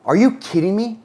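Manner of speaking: mock outrage, rising high on the second question; play-acting fury to match an upset parent; matching their anger. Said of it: "If they're mad, I'm mad".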